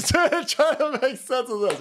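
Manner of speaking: high, drawn-out words through laughter; helpless laughing delivery, slowed down. Real, not acted. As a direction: crack up while saying it. Cracks up repeating himself.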